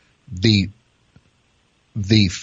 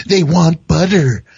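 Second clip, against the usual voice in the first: panicked fake cook; 8 semitones higher than usual. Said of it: "be like".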